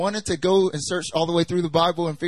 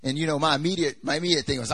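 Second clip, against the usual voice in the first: ordinary delivery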